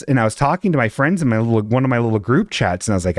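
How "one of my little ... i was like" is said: normal talking voice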